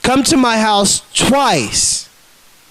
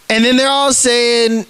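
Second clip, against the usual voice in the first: ordinary talk, nothing marked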